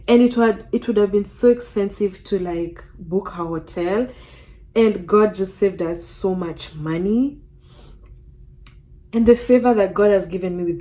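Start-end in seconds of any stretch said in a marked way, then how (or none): none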